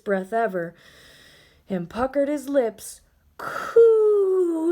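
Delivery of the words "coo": much higher, louder crying wail; a ghost wailing coo instead of boo. Acted. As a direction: wail it out sobbing. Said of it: "he wailed".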